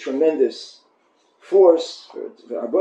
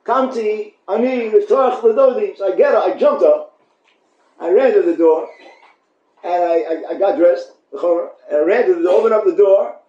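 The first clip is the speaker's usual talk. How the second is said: louder, breathless run to the door; excited rush; 5 semitones higher, 5 dB louder than usual. Acted. Bride jumping up to open for her beloved, excited and loud. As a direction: leap up and race to open.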